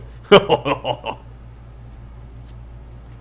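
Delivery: hammed-up dwarf laugh, drawn out; character's laugh. Dwarf guide takes the complaint as a joke, acted laughing. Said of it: "he laughs. He thinks it's a joke".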